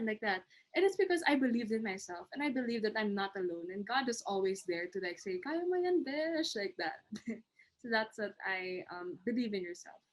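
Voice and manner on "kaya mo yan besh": cheering friend. Retold or imitated. God voiced as cheering friend.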